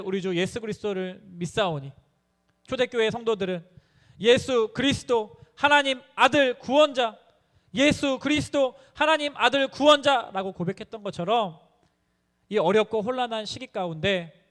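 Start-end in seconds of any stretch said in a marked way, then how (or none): 4.2-10.5 higher, louder and slow, each word weighed; solemn confession. Acted. Preacher chanting the early church confession, slow and loud. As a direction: intone it like a creed.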